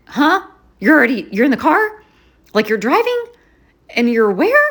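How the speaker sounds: reacting in disbelief to the news; surprised. To a friend who is already driving over, shocked.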